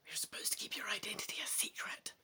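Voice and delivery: soft, sharp, fast unvoiced whisper. A narrator's reading. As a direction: hiss the warning so no one overhears. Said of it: "he's meant to whisper".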